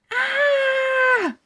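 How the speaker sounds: put-on shrill startled girl scream; high scream. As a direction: shriek in terror. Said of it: "Judy screamed".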